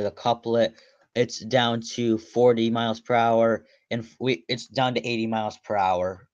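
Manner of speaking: ordinary talk, nothing marked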